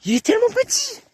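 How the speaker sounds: very high, excited squeal; amazed squeal. Real, not acted. Shooter sees how small the target is, amazed high squeal. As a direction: squeal it in real disbelief. Stupéfait par la petite cible.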